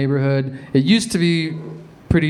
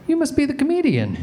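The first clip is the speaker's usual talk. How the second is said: put-on old lady voice; 10 semitones higher than usual. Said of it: "This old lady saw me and she goes".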